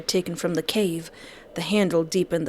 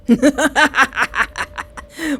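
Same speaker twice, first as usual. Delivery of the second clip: villain laugh; 7 semitones higher, 5 dB louder than usual. Acted. Villain laughing over victim.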